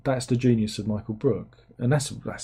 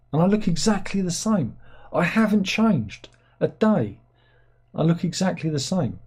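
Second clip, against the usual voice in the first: mock proud; 7 semitones higher than usual. Jokes about old photo of himself.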